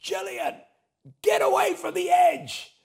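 sudden high-pitched shout across a crowd; panicked yell. Retold or imitated. Husband yelling to his wife at the cliff edge, panicked shout. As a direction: yell it out without thinking, voice shooting way up. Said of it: "I just yell out".

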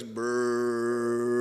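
low, rumbling lip-trill engine noise; engine brrr. Retold or imitated. Preacher imitating a go-kart as it slows, low rumble. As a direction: make the engine sputter. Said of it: "this is what starts happening when it goes".

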